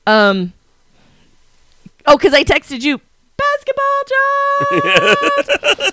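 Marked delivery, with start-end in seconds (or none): 3.4-5.9 very high, stretched-out squeal; freaking out. Retold or imitated. Fan reading her freak-out text to co-host, squealing.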